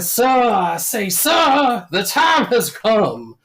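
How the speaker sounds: high, slow, loud Southern colonel call. Retold or imitated. Colonel calling Homer out for the duel, slow Southern drawl. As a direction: call him out like a Foghorn-style colonel. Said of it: "our dueling colonel".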